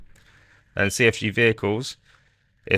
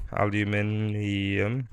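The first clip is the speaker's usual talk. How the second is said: very slow, stretched-out syllables; sounding it out; 4 dB quieter, much slower than usual. Real, not acted. Streamer types a word he can't spell, sounding it out slowly.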